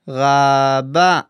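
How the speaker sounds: Raba drawn out, clear stress on ba; slow clear Hebrew model word. Shown as a demonstration. Teacher modeling Raba for students, slow and clear. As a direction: model Raba carefully for learners. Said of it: "Let's hear it again".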